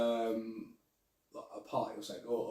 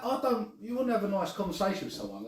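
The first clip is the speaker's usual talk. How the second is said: ordinary talk, nothing marked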